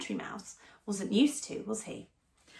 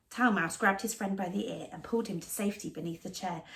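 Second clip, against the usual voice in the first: ordinary delivery, nothing marked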